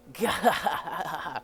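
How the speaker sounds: loud rolling belly laugh; hammed-up loud cackle; acted guffaw. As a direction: laugh big at someone else's trouble.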